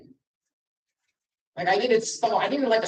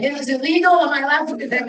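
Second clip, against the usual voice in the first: a higher, anxious mock worry; worried; 7 semitones higher, 5 dB louder than usual. Audience member nervously asking if it is allowed, mock worried. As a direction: sound nervous about breaking the law. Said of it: "Very worried".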